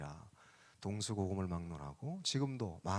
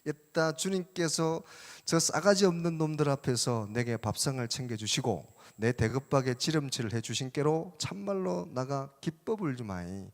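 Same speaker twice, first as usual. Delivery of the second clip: put-on lively, higher-pitched Jeolla-accented recitation; Jeolla dialect; 4 semitones higher, 5 dB louder than usual. Preacher entertains listeners with a parody psalm, in Jeolla dialect. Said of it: "전라도 버전".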